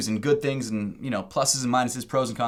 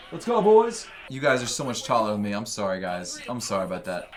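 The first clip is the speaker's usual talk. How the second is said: normal talking voice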